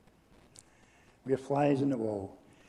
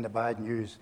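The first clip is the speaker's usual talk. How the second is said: ordinary talk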